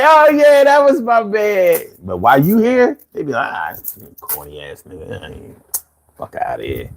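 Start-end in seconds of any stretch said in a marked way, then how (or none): 0.0-1.8 a fake fond claim in a mock crowd voice; mock hometown fan. Host mocking the Philly crowd that claims you once you are famous, in a high mock voice. 3.5-6.9 low, much softer scornful brush-off; a muttered put-down in a lightly voiced hater; mock dismissive. Host imitating Philly locals who dismiss you at home, low and soft.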